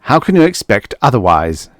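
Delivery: loud angry shout; husband shouting at his wife. Acted. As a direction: yell it back at her. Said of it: "he shouted".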